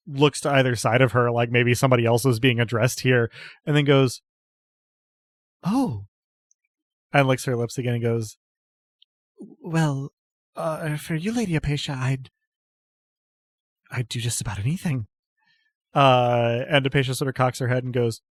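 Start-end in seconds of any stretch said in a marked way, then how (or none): 5.6-15.1 put-on a devoted girl trailing off shyly; flustered devotee. Ilona answers her lady, stammering and fading away.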